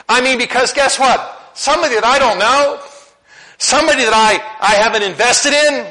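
high and loud, pressing the point home to the church; preaching at full force. Acted. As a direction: preach it up to the back row.

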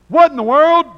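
high, loud shocked shout; incredulous outcry. Acted. Officers crying out to Pharaoh, shocked and loud. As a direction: burst out in disbelief. Preacher bursts out reading officers' cry.

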